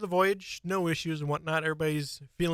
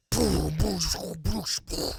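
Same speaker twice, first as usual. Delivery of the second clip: explosion sounds; 3 semitones higher than usual. Acted. GM voicing cannon explosions.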